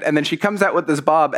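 normal talking voice

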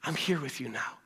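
low, very soft comfort; compassionate reassurance. Acted. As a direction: drop to a hushed, tender reassurance. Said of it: "He's showing compassion to say".

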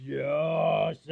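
long, stretched-out name in a put-on voice; hammed-up old woman stretching the name; Anna's drawn-out call. Anna greets young Joseph, stretching out his name. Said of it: "she said".

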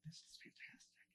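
whispering excitedly to himself; hushed, gleeful aside; whispered delight. Retold or imitated. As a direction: whisper it like a secret thrill. His delighted private reaction, retold.

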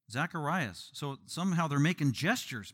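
ordinary talk, nothing marked

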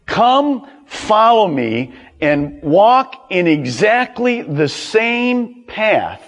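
slow, emphatic, starting high; deliberate command. Acted. Jesus tells followers his path, slow emphatic command. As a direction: spell out the order word by word. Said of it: "it actually means this".

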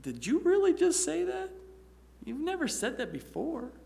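high, disbelieving exclamation; bewildered. Retold or imitated. Friend amazed at a kind reply, high and bewildered. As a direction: sound floored by an unexpected kindness. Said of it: "still bewildered".

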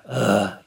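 low annoyed ugh; exasperated sigh. Shown as a demonstration. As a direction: sigh like a tired parent. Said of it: "that's not like a".

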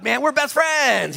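gushing like a fan; mock boast. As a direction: fan thrilled by a celebrity's nod. Boasting after a nod.

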